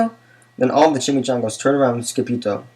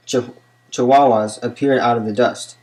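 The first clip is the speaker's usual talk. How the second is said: usual speaking voice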